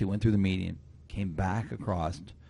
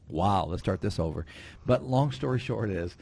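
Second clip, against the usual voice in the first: ordinary talk, nothing marked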